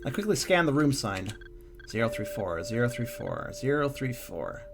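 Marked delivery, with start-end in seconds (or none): 1.9-4.6 soft inner-thought voice; quiet murmured recitation to oneself; thinking to oneself. Acted. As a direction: repeat it softly, as if memorizing. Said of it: "I thought to myself".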